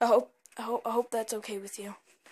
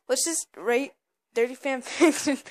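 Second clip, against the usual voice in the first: laughing; 3 semitones higher, 6 dB louder than usual. Retold or imitated. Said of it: "while you're over there just like".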